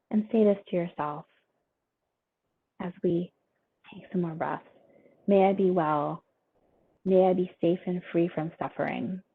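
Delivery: soft, unhurried meditation guide voice; guided meditation. Acted. Teacher guiding the class through a breathing meditation, softly. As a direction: talk them gently through each breath. Leads a short RAIN meditation.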